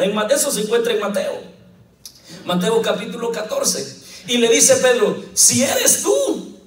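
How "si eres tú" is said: high, slow, hesitant Peter pleading; doubting Peter. Acted. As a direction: voice Peter unsure, drawn out high. Preacher voices doubting Peter.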